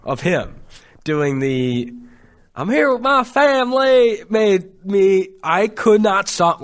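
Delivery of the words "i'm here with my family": high, slow put-on Southern accent; Brian Kelly's fake Southern accent. Host mimicking the coach's LSU intro in a mock Southern accent. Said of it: "this fake Southern accent".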